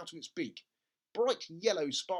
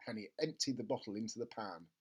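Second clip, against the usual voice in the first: ordinary delivery, nothing marked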